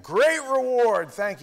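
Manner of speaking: high, loud and slow mock delight; sarcastic. Husband mocking the idea of heaven apart, sarcastic. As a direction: drag it out sarcastically.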